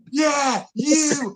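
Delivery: high mock holler; Jerv yelling. Friend answering the offended girls directly, mock yelling. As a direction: call back in an imitated taunting yell. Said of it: "can't be yelling at us".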